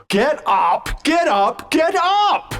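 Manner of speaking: rising, desperate command to get up; urgent shout. Acted. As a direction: shout it high and loud. Urging fallen companion to rise.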